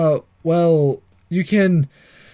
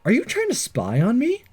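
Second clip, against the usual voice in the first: put-on higher, startled young hero voice; voicing the protagonist; 6 semitones higher than usual. Flustered hero confronting the ninja girl, higher pitched. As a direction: read it as the flustered hero catching a spy.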